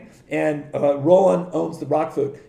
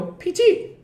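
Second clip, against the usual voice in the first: teasing nickname. Retold or imitated. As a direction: sing the nickname way up high.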